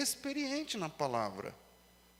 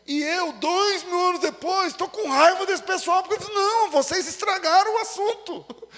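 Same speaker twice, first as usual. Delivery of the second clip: mock anger; 10 semitones higher, 9 dB louder than usual. Preacher mock-angry at first readers.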